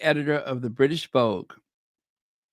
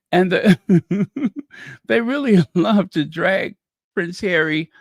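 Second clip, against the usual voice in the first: chuckling through the words; laughing; 4 dB louder than usual. Real, not acted. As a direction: break into a laugh mid-sentence, louder at first. Chuckles while mocking the press.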